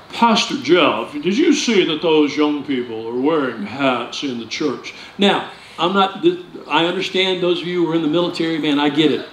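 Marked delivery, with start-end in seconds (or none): 0.1-4.9 put-on lower, slow, disapproving old saint; a hint of a grumpy complaint; sour church member. A sour old saint complaining to Pastor Jeff about hats, lightly voiced. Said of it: "seasoned sour saints come walk up".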